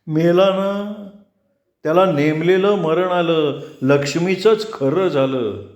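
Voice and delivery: drawn out as if unmoved; odd detached reply. A narrator's reading. Vaman coldly answers the death news, high and slow.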